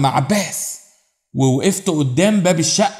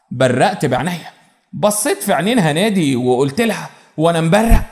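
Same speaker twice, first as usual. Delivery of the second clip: ordinary delivery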